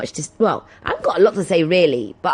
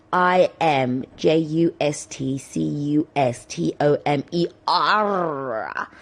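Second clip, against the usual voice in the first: letter by letter, slow and deliberate; spelled out slowly; 4 semitones lower, slower than usual. Shown as a demonstration. To listeners looking up the Twitter handle, slowly spelled out. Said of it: "Don't put the apostrophe".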